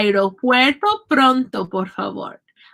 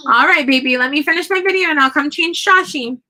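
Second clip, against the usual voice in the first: raised, louder voice to someone in another room; calling out; 3 semitones higher, 3 dB louder than usual. Real, not acted. Parent breaks off the video to answer baby, real calling out. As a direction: call over sweetly but firmly, pitched up. Calls to family member off camera.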